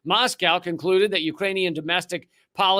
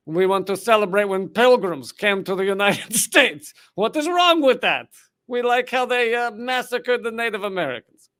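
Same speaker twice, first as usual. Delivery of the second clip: mocking Ukrainian nationalist; 3 semitones higher, 4 dB louder than usual. Mock voice of Nazi-linked group.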